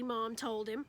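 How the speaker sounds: usual speaking voice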